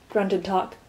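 ordinary delivery

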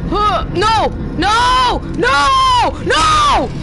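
panicked screams. Real, not acted. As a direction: scream in terror as it gets you. Being caught in game.

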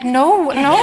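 high, loud mock protest from the producer; imitating Liz. Writer imitating her producer's shocked denial, high mock protest. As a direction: do her horrified "no" as a caricature. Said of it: "she'd be like".

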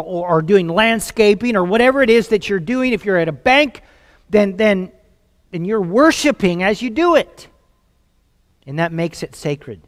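usual speaking voice

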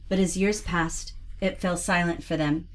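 soft, measured storybook ending; tender story reading. Acted. Reads sweet ending aloud.

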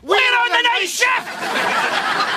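rowdy shout. Acted. Lads yelling out on the night shift.

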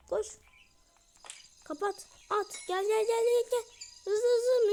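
high, loud rush of excitement; excited, high-pitched shouting; frantic urging. Real, not acted. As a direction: urge them on in a panicky hurry. Frantic escape from pursuer in game.

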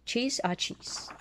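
usual speaking voice

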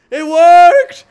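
high, loud triumphant cheer; excited cheer. Real, not acted. Gamer cheering to viewers, high loud excitement. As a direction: shout it out, thrilled it worked. Portal seems to work.

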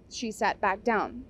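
ordinary delivery, nothing marked

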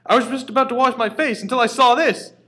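high, loud shaken character voice; upset cookie voice. Acted. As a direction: squeal it, still rattled.

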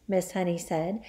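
ordinary delivery, nothing marked

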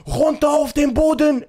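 loud barked order to get down; police command shout. Retold or imitated. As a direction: yell it like a raiding cop. (laut gebrüllter Polizeibefehl)

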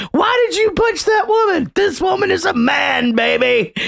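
high shouted quote; shouting. Retold or imitated. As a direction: yell it in a high voice. Retold movie quote, shouted.

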